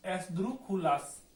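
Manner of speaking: एसद्रुकुलास spelled out slowly, syllable by syllable; slow pronunciation demo. Shown as a demonstration. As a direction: sound out एसद्रुकुलास slowly for learners. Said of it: "इसका नाम भी है एसद्रुकुलास". (एसद्रुकुलास को धीरे-धीरे, हर अक्षर साफ़)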